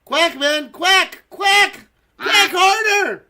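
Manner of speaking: high, loud, climbing quacks; building to a drawn-out high yell; urgent quacking shout. Pretend. Urges on a lagging duck.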